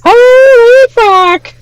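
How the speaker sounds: falsetto, long-drawn exclamation; imitated high-voiced guy. Streamer echoing the guy in the video, very high mimicry. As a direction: stretch it out in a squeaky falsetto. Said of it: "that don't have the deep voice".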